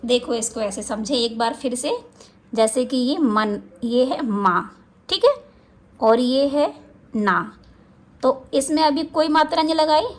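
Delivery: ordinary talk, nothing marked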